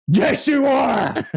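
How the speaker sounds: high, slow overjoyed shout; proud exclamation. Retold or imitated. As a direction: burst out with pride at the boy. Proud dad answering son.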